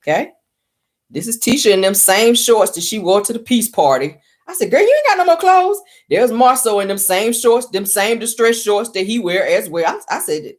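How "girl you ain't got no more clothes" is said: put-on high, loud sassy girlfriend call-out; sassy high shriek. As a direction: shriek it like a shocked friend. Sassy quote to Tisha.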